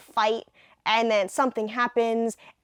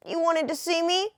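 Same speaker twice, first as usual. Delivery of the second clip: higher, lightly nerdy Clark; a hint of a timid reporter; 6 semitones higher than usual. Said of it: "Clark's gonna have a nerdy voice".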